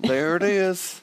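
dad teasing daughter's Texan-sounding line, mock Texan accent. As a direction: tease her with a Texan accent.

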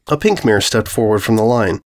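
ordinary delivery, nothing marked

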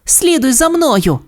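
high, drawn-out cartoon call; pup pilot's command. A narrator's reading. Sky tells Ace to follow her, high commanding pup voice. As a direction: give the order high and slow. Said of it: "наказала вона".

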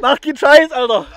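high, loud shout; loud disbelief. Real, not acted. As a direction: yell it in disbelief. Shouting at friend in lake. (laut und hoch rufen)

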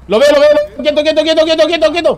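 high, loud urgent calling; urgent excited callout. Real, not acted. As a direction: call it out fast and urgent. Tank spotted, urgent callout.

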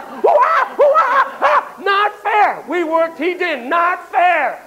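high, shrieking monkey protest; high whooping monkey yelling not fair; outraged monkey. Retold or imitated. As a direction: hoot and shriek like a cheated ape. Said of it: "They're like".

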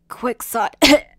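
calling out hoarse and strained. Acted. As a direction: call his name through the pain. Calling out to his enemy.

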